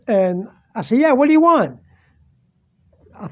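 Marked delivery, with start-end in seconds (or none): 1.0-1.8 higher, louder retold shout back; raised voice, answering a call; yelling back. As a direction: call back to whoever keeps calling your name. Yelling back at supposed sister.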